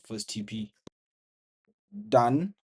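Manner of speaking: loud triumphant shout; excited. Real, not acted. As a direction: call it out in victory. First target hit.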